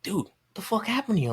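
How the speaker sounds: mock-incredulous question, voice jumping up; surprised greeting. Old acquaintance to him on running into him, surprised.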